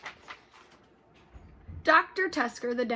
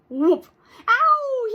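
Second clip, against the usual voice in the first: loud, high whoop then ow; yelled; 13 semitones higher, 15 dB louder than usual. Acted. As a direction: shout it, whoop into a yelp. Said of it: "yelled Wilbur".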